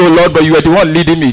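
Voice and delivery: quick, whiny protest; complaining believer. Retold or imitated. The troubled believer protests to the Lord, quick and whining. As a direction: blame the Lord in a rush.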